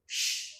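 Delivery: soft hushing sh, held; a quiet drawn-out sh; the sh sound. Shown as a demonstration. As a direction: say sh like hushing someone. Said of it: "Li pwononse tankou".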